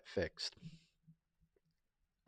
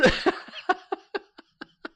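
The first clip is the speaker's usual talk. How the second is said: high squealing laugh; 4 dB louder than usual. Real, not acted. Cracking up after the impression.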